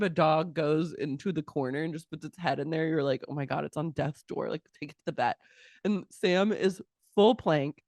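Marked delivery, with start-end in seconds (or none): 3.3-5.4 softer, lower worried aside; mock hushed concern. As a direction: fret quietly over a dying dog, half-joking. Mock concern over sick dog.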